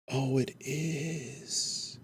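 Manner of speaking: normal talking voice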